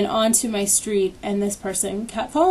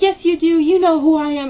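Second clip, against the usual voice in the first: loud, higher voice imitating an angry woman; mock yell, pitched up; retold screaming; 6 semitones higher, 6 dB louder than usual. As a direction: fire back like a screaming accuser. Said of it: "she just kept screaming at me".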